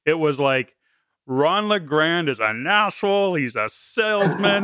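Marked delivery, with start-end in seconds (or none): 1.3-4.6 higher, slow scornful quote of the critics; mock critic. Host quoting members who disliked the coach, scornful. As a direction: sneer it like a disgruntled attendee.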